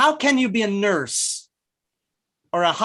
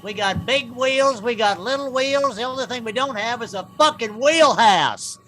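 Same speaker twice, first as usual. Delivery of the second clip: small-town late-night commercial; 6 semitones higher than usual. Acted. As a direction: sell it like a cheap local TV ad. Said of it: "Sounds like a commercial that runs at three A M in small towns".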